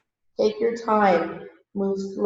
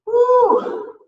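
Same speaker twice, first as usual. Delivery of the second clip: high, loud woo; sudden high-pitched woo of effort; strained woo; 7 dB louder than usual. Real, not acted. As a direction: whoop at a tight stretch. Feeling a tight stretch.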